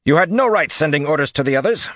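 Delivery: loud, high, forceful commander's tirade; angry rebuke. A narrator's reading. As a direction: dress down an officer at full voice. Commander angrily rebukes his officer.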